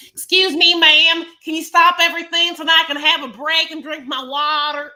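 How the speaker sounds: high, loud, put-on whiny plea; mock whiny student. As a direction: ham up a needy yoga student's interruption. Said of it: "no one's like".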